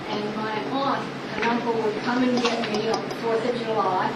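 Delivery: normal talking voice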